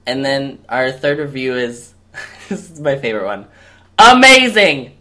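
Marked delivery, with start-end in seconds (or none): 4.0-4.9 very loud, drawn-out mock rave; hammed-up gushing. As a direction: belt out the rave like a superfan. Performs a gushing listener review.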